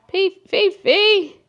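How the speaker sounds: high, slow sing-song call to the dog; pet talk. Real, not acted. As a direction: coo the dog's name, stretched and high. Calling the dog.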